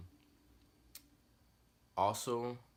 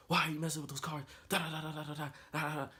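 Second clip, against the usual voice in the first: higher mock scolding rant; imitating dad snapping; 9 semitones higher, faster than usual. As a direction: scold like a fuming father. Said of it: "He was snapping".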